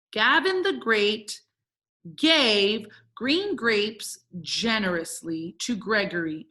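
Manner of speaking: slow, word-by-word reading; slow dictation. Shown as a demonstration. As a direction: spell it out slowly as if writing along. Teacher reading out while writing.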